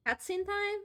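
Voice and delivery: loud rising "Cutscene time?"; excited question. Real, not acted. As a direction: ask "Cutscene time?" loud with a high rise. Asks if cutscene starts.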